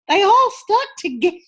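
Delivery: high-pitched mock wail; imitated dismay. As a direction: mimic a friend's dismay, pitch way up. Voicing friend's dismay at failed trick.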